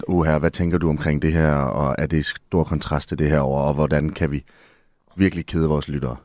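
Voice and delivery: mock analytic radio host. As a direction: parody a droning, overly analytical radio host. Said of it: "Der er mange, der taler sådan meget sådan".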